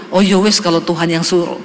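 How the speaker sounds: menirukan suami dengan nada lebih rendah, ringan; "ya wes" diucapkan santai dan pasrah ala Jawa; suami yang pasrah. Retold or imitated. (suami mengalah pada istri, nada rendah dan pasrah)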